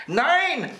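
put-on very high childish yell; shrieked refusal. A narrator's reading. Häwelmann refuses the old moon, high shriek. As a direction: shriek it like a defiant little boy. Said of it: "schrie Häwelmann".

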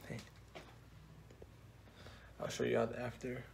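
ordinary delivery

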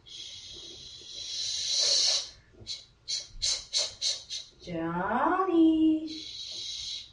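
sharp shushes around a long sing-out of the name; insistent shushing. Retold or imitated. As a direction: shush someone who won't stop talking. Acts out repeatedly shushing Johnny.

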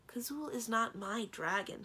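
measured, pointed rebuke; sharp. A narrator's reading. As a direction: correct them sharply, word by word. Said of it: "said sharply".

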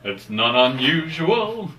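[name]. Retold or imitated. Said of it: "I feel like [name]".

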